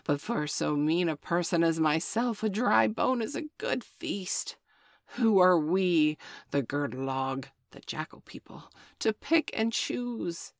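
grovel slyly, dropping to a whisper; fawning jackal. Acted. Jackal belittles himself to Father Wolf, sly and fawning. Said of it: "said Tabuki".

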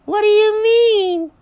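very high, slow, loud rabbit; over-the-top high cartoon introduction; absurd bunny voice. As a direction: stretch it out in a hammed-up squeal. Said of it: "is that how you talk?".

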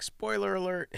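high, drawn-out mock warning; playful announcement. Shown as a demonstration. Warning listeners before revealing the plot, playful announcement. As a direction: sing out the warning, stretching it high.

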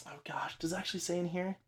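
whispered to herself; muttered under breath while searching notes. Real, not acted.

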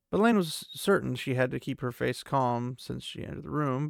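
ordinary talk, nothing marked

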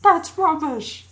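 drawn-out, high British heckle; UK fan impression. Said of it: "I can hear a U K person go".

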